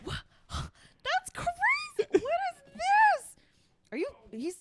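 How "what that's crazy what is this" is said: higher, slow gasp of feigned surprise; hammed-up discovery; mock surprise. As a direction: gasp as if you just found it. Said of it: "I'm like, Oh my God".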